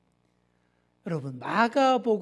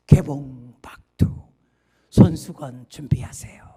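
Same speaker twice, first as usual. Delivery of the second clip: dramatic trailer whisper; 6 semitones lower, 8 dB louder than usual. Pretend. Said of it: "예고편 성우처럼 제가 한번 해보겠습니다".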